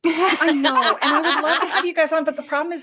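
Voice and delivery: laughing. Real, not acted. Podcast host laughing as a guest angles for an invite. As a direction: laugh it off while apologizing.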